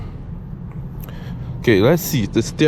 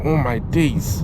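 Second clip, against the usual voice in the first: amazed; much slower than usual. Real, not acted. Feels the acceleration kick.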